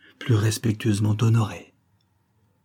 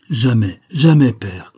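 louder, insistent vow; fervent cry; 2 semitones higher, 8 dB louder, slower than usual. A narrator's reading. Son vows to his father, loud and fervent. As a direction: hammer the jamais. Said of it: "répétai-je".

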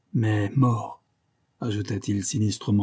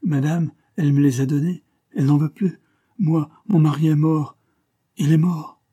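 high, loud, lightly whining complaint; aggrieved maid pleading her case; whimpering French maid; 6 semitones higher, 5 dB louder than usual. A narrator's reading. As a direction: protest plaintively, voice pitched up. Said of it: "pleurnicha-t-elle avec un fort accent français".

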